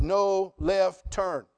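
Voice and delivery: slow sign reading. Retold or imitated. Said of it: "there was a sign said".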